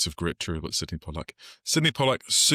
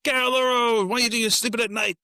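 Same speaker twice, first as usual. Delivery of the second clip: very high, raised put-on cabbie shout; mock taxi driver yell; 4 dB louder than usual. Taxi driver yelling at man in the road, mock shout. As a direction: holler like a film cabbie, voice pitched way up. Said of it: "the taxi driver who yells at".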